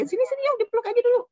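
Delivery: put-on high, quick cooing to an upset child; coaxing parent. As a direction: call the child over for a hug, high-pitched and loud. Parent comforting crying child, acted.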